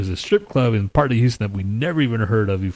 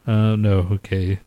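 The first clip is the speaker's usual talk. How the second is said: ordinary talk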